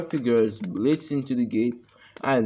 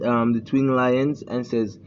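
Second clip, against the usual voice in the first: ordinary delivery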